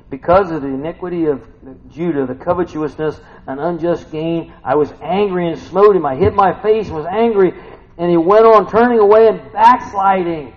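loud, rising scripture reading; forceful preaching. Acted. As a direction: build in volume and pitch toward the end.

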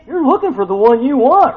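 ordinary talk, nothing marked

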